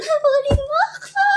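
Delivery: high, sing-song mock tease; playful parent. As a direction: squeal it like a grown-up playing tag. Parents playing chase at beach.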